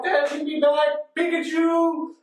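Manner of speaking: high, drawn-out mock-desperate demand; Ash pleading. Boy fighting the arms, high desperate plea. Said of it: "and he's like, he's like".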